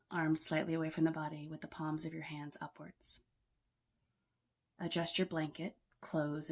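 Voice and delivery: measured meditation guide with pauses between phrases; even, unhurried yoga nidra voice; guided meditation. Real, not acted. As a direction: lead them gently into rest, leaving space after each instruction.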